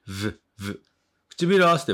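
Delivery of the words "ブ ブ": "ブ、ブ" as buzzed "vvv", lip on upper teeth; English v sound. Shown as a demonstration. Teacher demonstrating lip on teeth, buzzed v for "ブ". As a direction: turn "ブ、ブ" into two soft v buzzes. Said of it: "唇を軽く上の歯に当てて".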